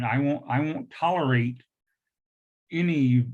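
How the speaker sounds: ordinary talk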